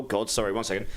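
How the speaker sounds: usual speaking voice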